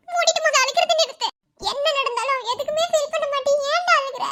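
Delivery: scolding a husband who won't stop crying; teasing scold. Acted. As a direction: tell him off to stop his crying, half laughing.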